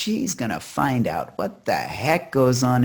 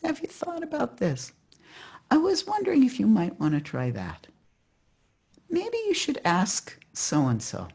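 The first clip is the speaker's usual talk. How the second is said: high, softened, tentative suggestion; acted rabbi offering careful advice; gentle advising rabbi; 10 semitones higher than usual. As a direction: float each idea up gently, with pauses. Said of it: "does what he always does".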